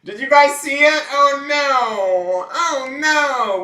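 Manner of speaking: high, loud excited squeal. Real, not acted. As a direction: shriek with excitement. Excited reaction to card.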